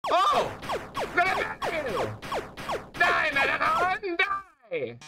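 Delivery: high, slow dying scream; villain death cry. Acted. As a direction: wail it out as you die.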